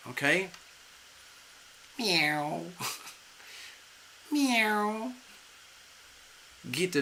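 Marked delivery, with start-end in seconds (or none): 2.0-5.1 a light mock cat meow, the second one laughing and rising; playful meow. Reader ending her cat metaphor, playful mock meow.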